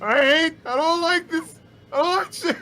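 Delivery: sputtering foiled villain. Acted. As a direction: sputter like a sore loser. Character ranting in a tantrum.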